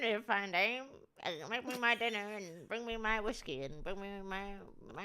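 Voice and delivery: mock old Hollywood accent; fifties movie accent. Movie man demanding whiskey, fifties movie accent. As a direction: order her about in a fifties film accent. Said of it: "they all talk like this".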